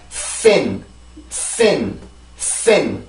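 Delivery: "sin" with a long hissed s before the falling vowel; strong airy S. Shown as a demonstration. Teacher demonstrating the S in "sin" for learners, strong airy S. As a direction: push plenty of air through the s of "sin", then land on the vowel. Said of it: "앞에 강한 공기가 굉장히 많이 나와요".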